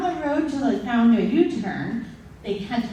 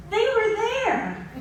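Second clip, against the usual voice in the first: incredulous; 11 semitones higher than usual. Retold or imitated. Disbelief at parents.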